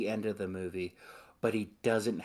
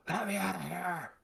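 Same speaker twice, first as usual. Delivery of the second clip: much higher mock monster cry; Deadite plea; 10 semitones higher than usual. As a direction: squeal it like a trapped demon. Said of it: "he's like".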